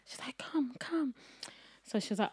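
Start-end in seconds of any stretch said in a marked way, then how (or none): 0.4-1.1 higher, whispered come come; whispered high-pitched summons; mock whispered beckon.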